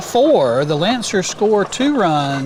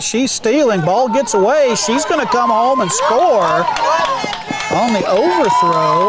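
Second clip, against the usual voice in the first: excited call; 4 semitones higher than usual. Real, not acted. Steal and score called live.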